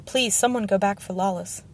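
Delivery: usual speaking voice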